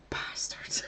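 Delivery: unvoiced whispered swear on a sigh; sighed curse. Partner sighing a curse in his sleep, whispered. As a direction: let it out as a defeated whisper. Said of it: "he just sighed and went".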